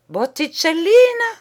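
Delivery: high ringing shout of her name; cry. Acted. The poet crying out the beloved's name, high and loud. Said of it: "cria le poète".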